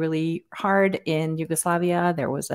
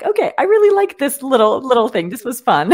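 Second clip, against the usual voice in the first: imitating her own thrilled reaction, much higher and louder; delighted inner voice; 7 semitones higher, 6 dB louder than usual. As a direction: play back your own happy little squeal of delight.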